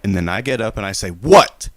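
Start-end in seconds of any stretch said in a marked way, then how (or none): 1.1-1.7 "What?" shouted much higher and louder; exaggerated retelling, confronting the laughing ladies with a shouted question; loud indignant what. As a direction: bark "What?" across the restaurant at the ladies. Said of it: "I was exaggerating".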